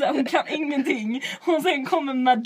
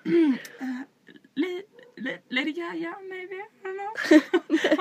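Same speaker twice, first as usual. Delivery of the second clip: higher, hesitant, shaky-sounding mock nerves; nervous answer; 6 semitones higher, slower than usual. Answering the host, mock nervous and halting. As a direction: squeak out the answer unsure. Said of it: "Och jag bara".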